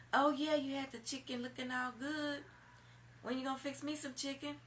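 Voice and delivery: high voice asking about chicken; imitating the boyfriend. Woman mimics boyfriend calling her at work, high mock voice.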